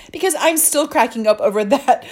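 words broken by laughter; a girl still laughing at the flower prank. Acted. She is cracking up.

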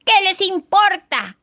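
high, loud, slow retort; eaglet shouting. A narrator's reading. Eaglet yelling back at the birds, loud slow shout. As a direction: bellow it with beak wide open. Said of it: "gritó el aguilucho abriendo grande el pico".

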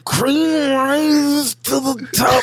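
high, slow, strained wrestling promo; Macho Man promo. Retold or imitated. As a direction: squeeze it out like you're in pain. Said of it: "Macho Man's cutting a promo".

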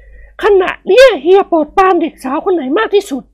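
high woman's voice, a touch of jealousy; jealous wife. Acted. Jealous wife asking which girl her husband favours, high voice. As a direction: press the machine, jealous. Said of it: "อารมณ์หึงเกิดขึ้นแก่นวลละออ".

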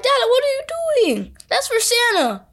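put-on high-pitched indignant child; shocked kid protest. Kid catching dad with Santa's cookies, shocked mock protest. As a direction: reenact a scandalized cry to a parent.

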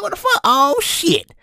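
high-pitched retold shock; startled alarm. Almost knocked off the road, startled alarm. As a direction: yelp it out as a startled reaction. Retelling near miss by car.

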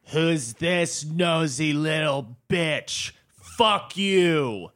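drawn-out mock heckle, imitating a lady; slow, mean put-on taunt; mean heckler. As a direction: stretch it out nastily, as a retold insult. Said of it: "it was so mean".

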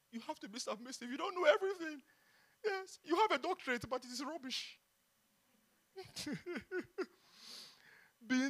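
ordinary talk, nothing marked

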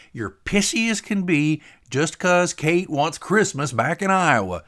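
high, loud, drawn-out jab; needling Tater. Acted. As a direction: accuse him with relish.

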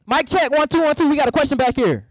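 high-pitched mic-check call; mock mic check. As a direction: call up for the mic like a sound tech. Calling for the microphone from back.

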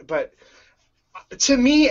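normal talking voice

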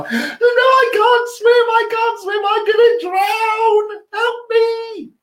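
slow, very high hammed-up wailing; mock panic. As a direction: drag out the fake cries, very high. Keeps faking drowning.

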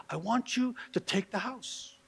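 soft, slow, deliberate quoted offer; hushed offer. Retold or imitated. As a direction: make the offer softly, word by slow word. Caller's offer acted out in story.

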